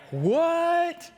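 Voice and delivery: high, startled "What?"; mock disbelief; baffled what. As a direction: jump up in pitch, caught off guard. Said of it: "And they're like".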